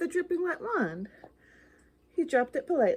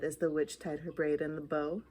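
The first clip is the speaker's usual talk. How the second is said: plain reading voice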